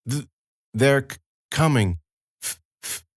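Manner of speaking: put-on halting, breaking girl's warning; frightened girl stammering a warning; stammered warning. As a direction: stutter out each word, half whispered.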